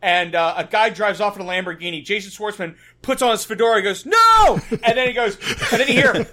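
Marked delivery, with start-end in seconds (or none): 4.0-4.6 high, very loud drawn-out "No!"; hammed-up high-pitched yell; mock dramatic shout. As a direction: cry out in over-the-top movie horror, imitating an actor. Acting out commercial's dramatic shout.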